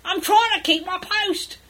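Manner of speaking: high, loud, slow drawn-out cry; Beaver protesting. Acted. Beaver hauled away by Narnian guards, high loud protest. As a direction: call it out high as you're dragged off. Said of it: "getting dragged away by some".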